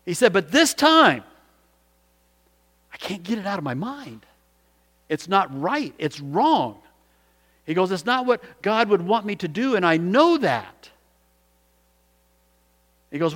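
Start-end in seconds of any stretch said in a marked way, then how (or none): 0.3-6.8 distressed, high-pitched plea; troubled man confessing to his pastor; upset. Retold or imitated. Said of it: "he was really upset". 7.9-10.9 high, anguished admission; troubled insistence, slowing on the last words; upset. Retold or imitated. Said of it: "he was really upset".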